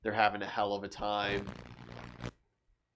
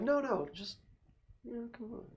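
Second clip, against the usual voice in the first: put-on drunk lady pleading; 12 semitones higher, 8 dB quieter than usual. Drunk woman pleading, voiced by GM.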